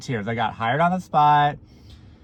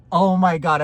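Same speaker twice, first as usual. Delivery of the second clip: ordinary delivery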